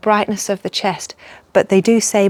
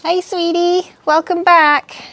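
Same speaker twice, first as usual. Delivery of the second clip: playful put-on voice; 7 semitones higher, slower than usual.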